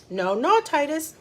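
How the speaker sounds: ordinary delivery, nothing marked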